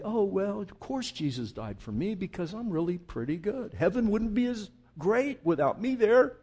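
smug, self-satisfied mock voice rising high at the end; mock blasé smugness. Preacher mocking a smug churchgoer's blasé attitude. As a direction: parody a complacent believer. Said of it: "If it's blasé".

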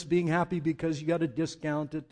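ordinary talk

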